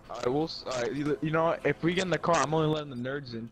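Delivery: loud. Real, not acted. Rallying team loudly.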